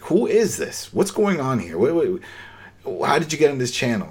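mildly startled, as if a stranger cut into her channel; startled radio voice. Retold or imitated. Beer lady on the walkie catching an intruder, mildly startled.

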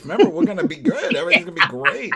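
laughing, high, fast sweet talk; mock lovey-dovey. Man coaxing her like a sweetheart, laughing and high-pitched. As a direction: sell the couple fantasy through laughter.

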